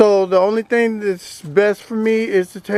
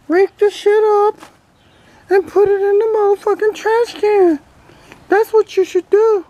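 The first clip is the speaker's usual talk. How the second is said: higher, cheeky toy duck voice; duck puppet voice; 10 semitones higher than usual. Acted. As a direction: voice the toy duck answering back. Ducky scolds the owner.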